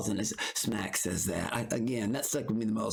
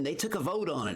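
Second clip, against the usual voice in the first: normal talking voice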